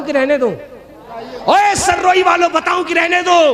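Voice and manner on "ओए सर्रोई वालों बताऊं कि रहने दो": high, loud shout to a crowd; calling out. Real, not acted. Preacher challenging the people of Sarroi, high rallying shout. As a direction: holler it up high to the back rows.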